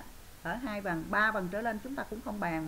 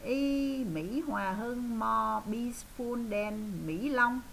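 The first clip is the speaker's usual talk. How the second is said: slow model sentence; 3 semitones higher, much slower than usual. Shown as a demonstration. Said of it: "Thì mình nói làm sao?".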